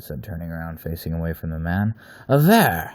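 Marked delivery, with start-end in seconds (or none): 2.3-2.9 loud, high sudden call of his name; sharp exclaim. Acted. Wolf man jolts captive Aver by shouting his name. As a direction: snap his name out loud to seize attention. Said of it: "it expl-, exclaimed".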